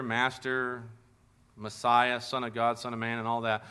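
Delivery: normal talking voice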